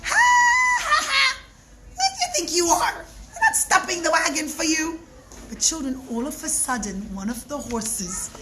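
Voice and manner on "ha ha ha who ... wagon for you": much higher, fast jeering laugh; mocking laughter. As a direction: cackle at the prisoner. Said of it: "they started to laugh".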